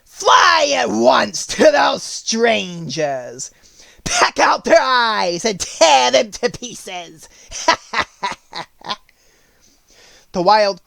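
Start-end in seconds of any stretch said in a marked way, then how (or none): 0.0-7.3 high, slow, wicked witch voice. Acted. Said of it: "the Wicked Witch said". 7.5-8.9 cackling witch laugh; wicked witch cackle. Acted. Witch laughs after command.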